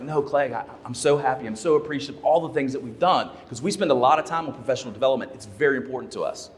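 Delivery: ordinary talk, nothing marked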